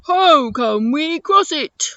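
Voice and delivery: big, loud elephant voice; Eddie the elephant. Acted. Elephant asking how to cross the desert, loud creature voice. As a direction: boom it out as a friendly storybook elephant. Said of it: "let's see if I can make an Eddie the elephant voice".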